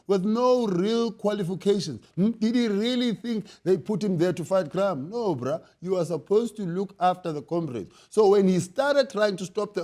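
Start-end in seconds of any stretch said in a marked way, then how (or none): none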